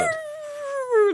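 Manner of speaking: high yowling dog howl; dog impression. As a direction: howl like a talking Frenchie. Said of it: "I'm gonna do an impression of him".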